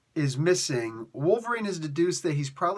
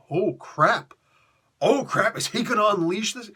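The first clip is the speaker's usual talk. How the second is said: raised, louder acted shock; mock alarm; 6 semitones higher, 3 dB louder than usual. Reader reacting to caged Wolverine, mock alarm. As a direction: play a startled onlooker's reaction.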